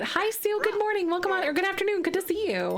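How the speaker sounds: high, bright welcome to a new arrival; excited greeting. Real, not acted. Streamer greets viewer Sue in chat, excited and high. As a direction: greet her with a big lifted voice.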